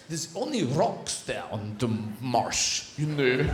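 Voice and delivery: put-on slow, low Russian accent. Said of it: "But with a Russian accent".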